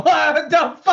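high mock-outraged yell; startled outburst. Co-host imagining the crash victim's reaction, mock outrage. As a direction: burst out in imagined disbelief.

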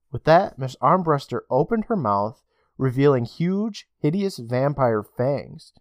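ordinary delivery

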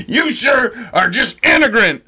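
mock insult. Mocking someone in an argument, pompous put-down. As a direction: fire off a grand insult, hammed up. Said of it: "if you ever wanted to use it in an argument".

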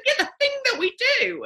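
high, quick insistence; insisting husband. Retold or imitated. Husband urges wife to tell the medium, high and fast.

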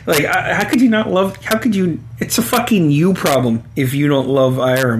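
usual speaking voice

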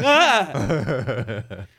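loud scream breaking into laughter; laughing mock scream. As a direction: shriek in jokey fright. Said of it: "And I was just like".

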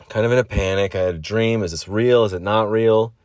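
normal talking voice

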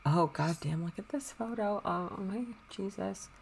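very soft, half to oneself; hushed awe. Real, not acted. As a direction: murmur it, floored by the photo. Looking at the photo.

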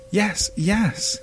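high, distant pleading yes; desperate answer. Acted. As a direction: call up from the grave. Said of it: "came the answer from deep below".

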